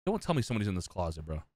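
low, quick and quiet; hushed, hurried disbelief; uneasy aside. Real, not acted. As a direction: say it fast under your breath. First uneasy take, then repeated.

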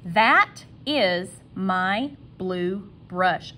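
very slow "That is my blue brush", separated words. Shown as a demonstration. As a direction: spell out the sentence slowly, word after word. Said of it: "now my sentence".

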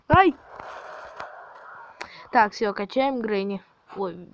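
normal talking voice

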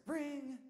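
high ringing phone sound; imitated phone ring. Imitates the band room phone ringing.

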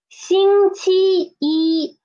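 星期一 all high and level, drawn out; first-tone yi. Shown as a demonstration. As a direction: slowly chant out 星期一 in steady first tones. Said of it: "so it won't change too".

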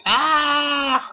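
high-pitched "ah" yelp; mock scream. Gamer acts out being attacked by their hair, mock scream. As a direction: let out a high, startled mock cry.

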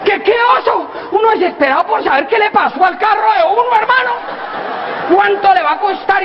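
high, incredulous outburst; exasperated man. Acted. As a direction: sputter in disbelief. Hombre desesperado por el carro. (hombre desesperado por el carro, agudo e incrédulo)

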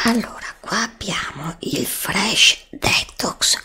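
ordinary delivery

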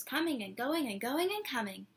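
usual speaking voice

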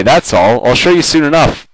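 ordinary delivery

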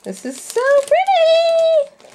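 very high, slow squeaky coo; squeaky gush. Real, not acted. Crafter admiring a piece for viewers, very high squeaky coo. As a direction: squeak with delight. Gushing over craft supplies.

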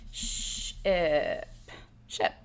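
sh, I, p said apart, then blended into ship; segmenting sounds. Shown as a demonstration. Teacher showing students how to segment ship. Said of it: "have them just read it now".

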